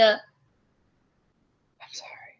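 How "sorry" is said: breathless whispered sorry. Real, not acted. Stifling laughter, she whispers sorry to her partner. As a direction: barely breathe the apology.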